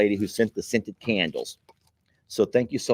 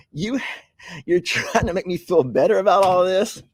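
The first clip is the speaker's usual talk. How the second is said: laughing protest; 9 semitones higher than usual. Real, not acted. To viewers joking about his colonoscopy, laughing protest. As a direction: squeal it out through laughter.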